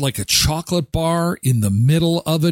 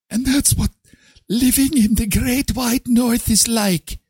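higher, put-on solemn storyteller voice; hammed-up epic narrator; mock grand storyteller; 7 semitones higher than usual. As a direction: spin the tall tale like a fairytale. Mock grandiose Canada tale.

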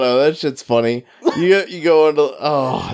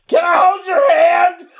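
slow, high, loud shout; mock yell; 3 dB louder, slower than usual. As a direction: yell it slowly, much higher and louder. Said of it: "try to whisper something".

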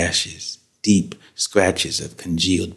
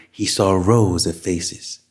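usual speaking voice

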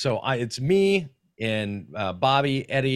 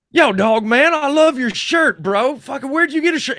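high, loud hyped-up fan voice; imitating a stranger who loves the shirt; excited stranger; 14 semitones higher, 8 dB louder than usual. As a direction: call out across the store, thrilled. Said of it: "I hear this guy go".

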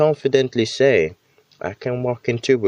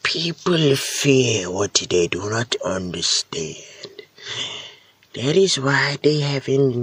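slow, solemn wise-man line, a hint of sage; lightly voiced wise man; 4 semitones higher, slower than usual. Quoting a TV wise man to listeners, slow mock-solemn. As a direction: deliver it like a lightly played TV sage, slowly. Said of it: "where some wise man says".

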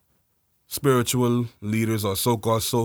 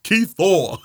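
loud, high call like a parent; mock parent call; 5 semitones higher, 6 dB louder, much slower than usual. Calling Keith Thor home like a parent, loud. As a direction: yell for the kid like an old parent.

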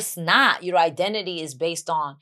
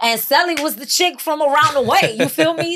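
put-on higher, louder, around-the-way girl; street persona voice; 9 semitones higher, 6 dB louder than usual. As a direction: put on the block-girl attitude. Playing her street persona.

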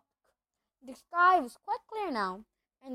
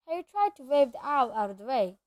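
usual speaking voice

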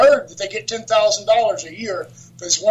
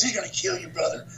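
very soft retold warning; hushed warning; 4 semitones lower, 10 dB quieter than usual. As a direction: lean in and warn him quietly. Scared wrestler quietly warns him.

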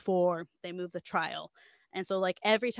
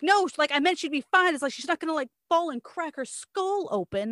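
a hint of a sister protesting, higher and louder; 7 semitones higher, 5 dB louder than usual. Tiffany defending herself to the defense, mildly higher. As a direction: insist she meant it, pitched up. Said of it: "Where Tiffany is like".

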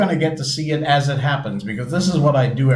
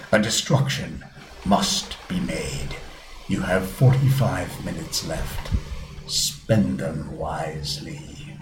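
deep villain voice; 4 semitones lower than usual. Shown as a demonstration. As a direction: read the threat like a doomsday villain. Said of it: "reading this in that other voice".

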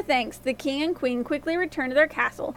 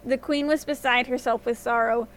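plain reading voice

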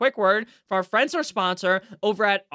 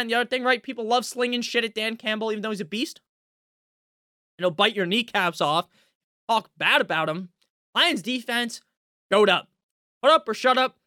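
normal talking voice

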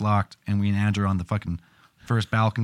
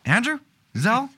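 calling out; 11 semitones higher than usual. Retold or imitated.

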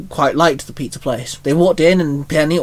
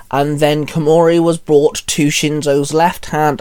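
usual speaking voice

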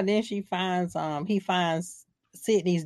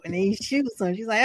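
usual speaking voice

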